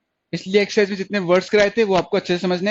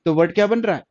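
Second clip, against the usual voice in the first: ordinary talk, nothing marked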